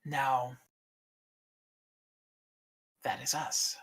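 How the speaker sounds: hushed, measured ancient dragon; a stern guardian, softly grave; solemn dragon keeper. Acted. Egg Keeper continues.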